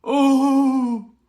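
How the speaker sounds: loud high wailing howl; howl like a wounded dog. A narrator's reading. Hagrid breaks down over baby Harry, loud howl. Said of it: "let out a howl like a wounded dog".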